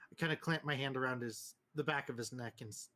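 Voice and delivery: ordinary talk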